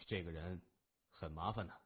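low, soft, veiled hint; cool gang boss. A narrator's reading. Gang boss hinting about the captive beside him, low and cool. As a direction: drop the hint quietly and low.

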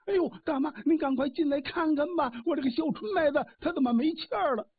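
high, frightened calling for help; panicked call. A narrator's reading. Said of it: "有人就喊". (惊慌地高声呼喊)

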